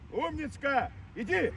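ordinary talk, nothing marked

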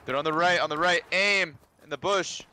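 high, loud urgent callout; shouting. Real, not acted. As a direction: shout the enemy position urgently. Calling out enemy positions.

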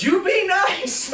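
high, loud mock scolding through a laugh; mom scolding. Mom scolding the nurse, loud and laughing. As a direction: play mom telling him off, laughing. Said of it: "mom goes".

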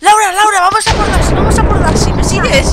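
loud urgent calling to a friend. Real, not acted. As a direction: raise your voice to rally her. Calling teammate across game.

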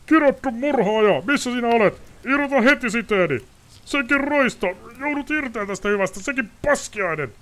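very high, loud furious cursing; tied-up lord yelling curses into the dark; bound nobleman screaming. Acted. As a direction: rage against the ropes. Bound Ostrid raging.

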